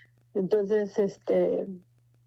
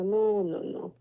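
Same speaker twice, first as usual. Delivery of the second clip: ordinary talk, nothing marked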